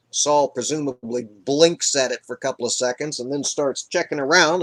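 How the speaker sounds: usual speaking voice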